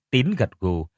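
ordinary delivery, nothing marked

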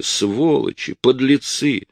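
high hysterical outburst; hysterical shouting. A narrator's reading. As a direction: shriek the insults. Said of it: "истерически кричала Рая". (истерический крик)

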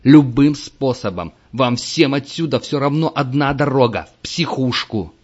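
yelling at full volume. A narrator's reading. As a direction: bellow at the lazy player. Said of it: "он орал на Картри во всю глотку". (орёт во всю глотку)

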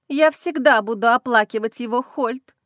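ordinary delivery

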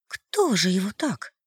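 high, slow frightened question; trembling hushed. Acted. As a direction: ask in shocked horror. Masha shaken over the body. (испуганно, медленно)

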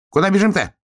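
high-pitched urgent question; calling out. A narrator's reading. Hedgehog calls after fleeing bear cub, calling out. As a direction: call it high to a runner ahead.

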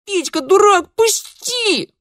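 put-on very high, loud girl's yell; squealing child. A narrator's reading. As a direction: squeal at him to let go. Girl struggling to break free.

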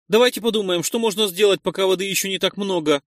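a hint of a nervous youth; lightly voiced young Nois. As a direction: push the group to think fast. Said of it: "предложил Нойс".

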